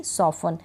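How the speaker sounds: "सॉफन" with a silent T; correct pronunciation. Shown as a demonstration. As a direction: repeat "सॉफन" without the T. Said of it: "क्या पढ़ूंगी".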